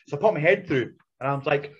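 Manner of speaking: usual speaking voice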